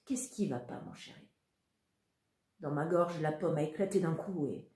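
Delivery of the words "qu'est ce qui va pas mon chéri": softer, tender mother's question; mother soothing her son, near a hush; gentle, soft. Acted. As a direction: comfort her crying son softly. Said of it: "m'a demandé doucement".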